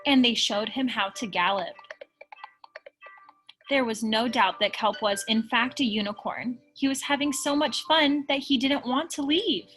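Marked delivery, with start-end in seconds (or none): none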